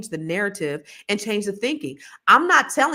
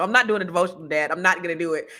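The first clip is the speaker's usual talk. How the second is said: ordinary talk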